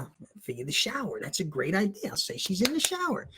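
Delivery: hushed, under the voice; quietly hatch a sneaky idea; thinking to himself. Retold or imitated. Teen works out an excuse for his teacher, hushed.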